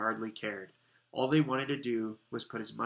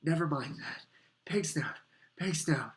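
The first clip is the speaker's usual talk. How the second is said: raised, out-of-breath bursts; panting; 5 semitones higher than usual. A narrator's reading. Harry at the portrait after running, panting. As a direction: pant the password in short bursts. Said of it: "panted Harry".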